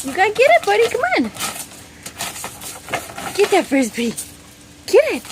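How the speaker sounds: high loud coaxing; excited dog talk. Real, not acted. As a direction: cheer the dog on loudly. Urging dog, then mocked.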